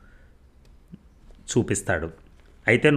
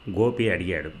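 ordinary delivery, nothing marked

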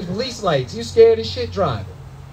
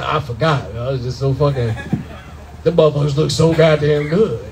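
stoned cousin; 5 semitones lower than usual. Retold or imitated. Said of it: "He's like".

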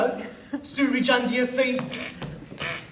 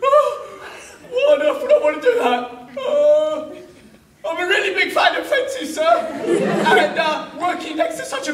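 higher, louder nervous innocence; flustered bluff; 4 semitones higher, 4 dB louder than usual. Acted. Baldric bluffing the governor, flustered. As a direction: stall while you think of a lie.

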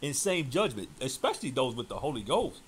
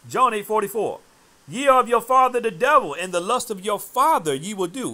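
loud, high scripture thundered out; preaching at full force; 7 semitones higher, 9 dB louder than usual. Acted. Preacher hurling John eight at his opponent, full-force preaching. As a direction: proclaim the verse like a pulpit warning.

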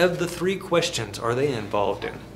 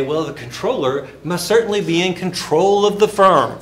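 1 mock confused person; 4 semitones higher, 7 dB louder than usual. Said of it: "or they'll say".

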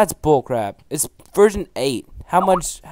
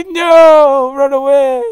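high, loud panicked yell; panicked shout; 11 semitones higher, 12 dB louder, much slower than usual. Real, not acted. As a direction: scream at them to flee. Panicked yelling at teammate.

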